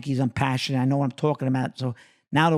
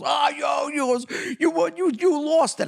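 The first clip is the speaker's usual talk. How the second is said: Sandler teasing; 9 semitones higher than usual. Retold or imitated. Sandler yelling at Nicky for losing it, teasing. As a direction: crow at him for losing it. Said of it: "And Sandler goes".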